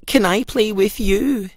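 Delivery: a light hint of a hopeful pumpkin; Pumpkin's voice. A narrator's reading. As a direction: ask shyly like a little pumpkin. Pumpkin asks the cauliflowers.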